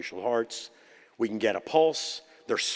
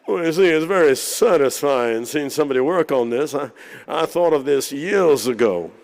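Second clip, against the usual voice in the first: imitating DeBakey; 3 semitones higher, 9 dB louder than usual. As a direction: put on the grand old surgeon, drawing out the words. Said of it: "I learned to imitate all these guys".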